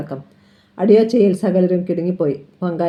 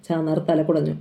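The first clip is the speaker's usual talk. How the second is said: plain reading voice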